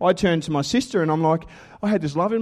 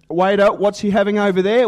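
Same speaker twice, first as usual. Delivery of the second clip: ad customer; 5 semitones higher, 6 dB louder than usual. Retold or imitated. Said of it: "the Special K ad".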